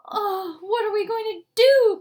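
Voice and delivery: high, loud wail; distressed cry. Acted. Mother crying out over her daughter, high and loud. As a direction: cry out in despair. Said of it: "cried Missus Scream".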